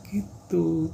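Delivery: ordinary delivery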